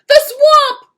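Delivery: loud, high shriek of fear; terrified cry. Acted. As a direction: scream her name in terror. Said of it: "cried the kids".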